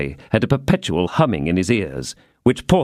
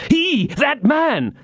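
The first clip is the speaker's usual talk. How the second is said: very high, frantic pointing cry; agitated outburst; much slower than usual. A narrator's reading. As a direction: insist wildly on that man. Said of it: "pale with passion".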